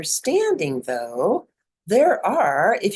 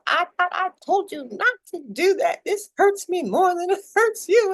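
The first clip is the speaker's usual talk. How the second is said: high, put-on voice of a scolding mom; 11 semitones higher than usual. Mother scolding her child before a spanking, mock stern. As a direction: ham up mom's stern lecture. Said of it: "like, you know".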